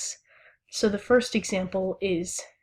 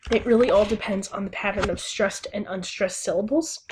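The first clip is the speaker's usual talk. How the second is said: ordinary talk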